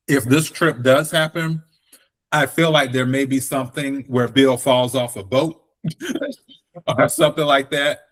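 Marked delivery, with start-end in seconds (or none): 5.8-6.3 high airy giggle; a quick thin laugh; high breathy laugh. Real, not acted. Laughing at own joke.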